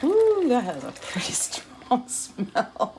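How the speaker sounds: slow, whispered, laughing. Real, not acted. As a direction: laugh it out under your breath. Strong vinegar smell.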